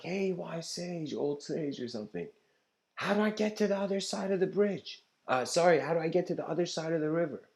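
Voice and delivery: high, hammed-up young seeker asking the way; eager seeker. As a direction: pitch it up like an eager pupil. Seeker asks the sage.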